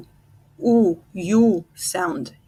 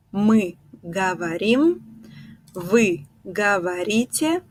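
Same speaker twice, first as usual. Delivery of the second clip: ordinary talk, nothing marked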